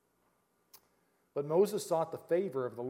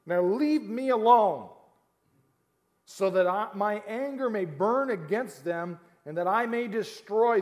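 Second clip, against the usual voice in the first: slow, loud wrathful voice; angry God; 4 semitones higher, 6 dB louder, slower than usual. Acted. God telling Moses to leave him alone, slow and wrathful. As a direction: rumble it out like burning anger.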